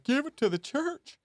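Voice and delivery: high, mocking preacher impression; sneering high preacher plea; televangelist voice. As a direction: ham up a greedy televangelist. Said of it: "He's like".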